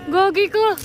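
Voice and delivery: loud, high, slow teasing cry; teasing exclaim. Real, not acted. Teasing the sulking friend about crying, loud and high.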